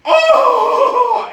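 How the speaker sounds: very high, loud yelp of pain; loud pained cry. Acted. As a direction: shriek like a bitten bird. Eagle bitten cries out.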